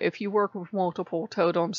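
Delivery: usual speaking voice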